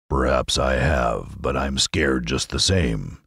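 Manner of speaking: low, slow big-cat voice, a little scared; the timid Lion rumbles it out, slowly; cowardly Lion. A narrator's reading. To Oz, the Lion admits he is still scared, low and slow.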